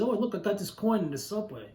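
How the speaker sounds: a hint of a thrilled coworker showing off a coin; excited coworker. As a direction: coworker bragging about a subway coin, mildly excited. Quoting the Manpower guy.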